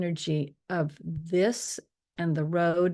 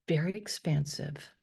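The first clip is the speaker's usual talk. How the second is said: ordinary talk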